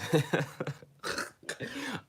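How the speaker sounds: high-pitched burst of laughter; helpless high giggle; self-amused laugh. Real, not acted. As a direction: crack up at your own reading. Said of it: "I'm only laughing 'cause".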